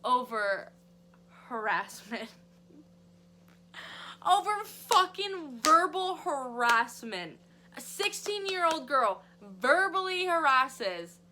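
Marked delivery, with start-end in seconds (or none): 4.3-11.2 outraged disbelief. Real, not acted. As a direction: drag it out in disgust. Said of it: "disbelief at firing reason".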